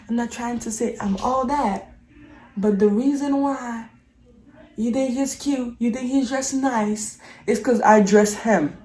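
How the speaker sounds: ordinary talk, nothing marked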